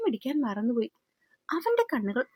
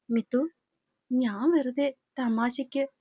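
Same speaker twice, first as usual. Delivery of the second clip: plain reading voice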